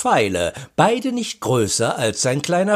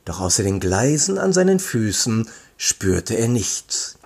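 ordinary delivery, nothing marked

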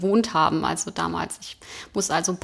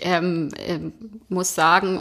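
usual speaking voice

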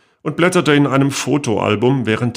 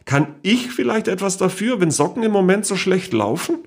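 much higher, sweet wheedling aunt; cajoling aunt; 9 semitones higher than usual. A narrator's reading. Great-aunt cajoling her niece not to report her, sweet and high. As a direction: coax and shrug it off innocently.

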